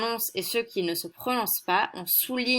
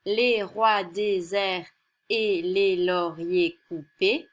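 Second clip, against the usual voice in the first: slow verse reading; slower than usual. Shown as a demonstration. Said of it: "le décasyllabe, qui est un vers de dix syllabes".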